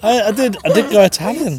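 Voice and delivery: loud, high laughing through words. Real, not acted. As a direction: laugh while admitting it. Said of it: "It's gone Italian".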